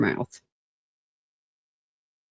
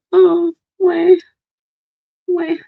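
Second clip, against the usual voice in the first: loud fake baby wah; hammed-up pretend crying; sarcastic fake boohoo; mock wailing; 12 semitones higher, 6 dB louder, slower than usual. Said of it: "I'm gonna cry".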